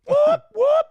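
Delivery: very high, loud siren whoop; police siren imitation. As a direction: whoop like a squad car.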